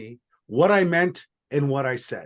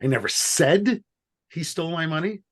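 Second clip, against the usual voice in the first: "said" stressed with a rise; 3 semitones higher than usual. Shown as a demonstration. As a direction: make "said" the key word. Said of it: "depending on which word I emphasize".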